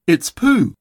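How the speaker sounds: delighted recognition, a short burst; excited. A narrator's reading. Christopher Robin recognising Pooh's singing, excited. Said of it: "said Christopher Robin excitedly".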